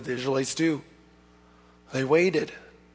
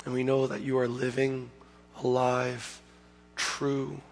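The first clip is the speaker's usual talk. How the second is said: soft, hushed pastoral prayer; quiet prayer; 6 dB quieter than usual. Real, not acted. Pastor leading the church in quiet closing prayer. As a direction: pray gently over the congregation.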